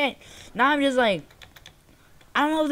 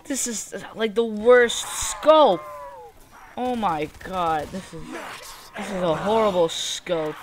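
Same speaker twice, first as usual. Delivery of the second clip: usual speaking voice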